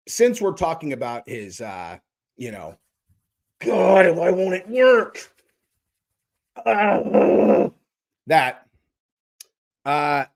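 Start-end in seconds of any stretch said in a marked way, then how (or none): 3.6-5.2 hammed-up exasperated wail, drawn out; mock frustrated. Man upset it won't work, acting frustrated. Acts out man whose body fails. 6.7-7.7 long, loud mock groan; frustrated groan. Man upset it won't work, mock frustrated groan.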